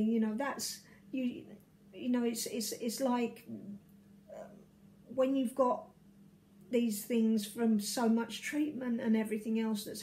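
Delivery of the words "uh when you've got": ordinary delivery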